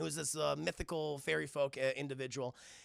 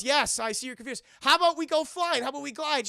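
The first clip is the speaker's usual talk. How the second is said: high, loud mock enthusiasm; eager urging; 7 semitones higher, 9 dB louder than usual. Goliath coaxing confused Elisa to fly, acted eagerness. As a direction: propose it loudly, pushing the idea.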